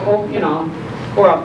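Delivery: ordinary delivery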